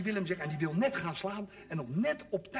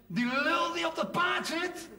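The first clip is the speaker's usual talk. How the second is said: mock shout through cupped hands; 8 semitones higher than usual. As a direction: call it out over the crowd, half mocking. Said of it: "Ik heb mijn handen aan mijn mond gezet". (spottend roepen, hoog en langzaam)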